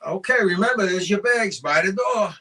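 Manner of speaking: stern warning. Acted. Wife reminding husband of his bags, loud warning. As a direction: lay down the warning, loud and firm.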